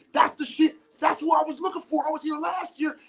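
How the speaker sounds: a fan finally meeting the maker, thrilled; excited stranger. Retold or imitated. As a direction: gush about finding the product. Stranger excitedly praising the product.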